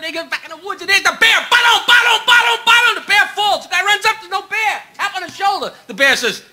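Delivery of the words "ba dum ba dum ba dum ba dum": imitated heartbeat.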